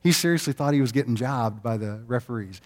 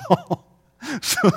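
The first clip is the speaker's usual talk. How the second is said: ordinary delivery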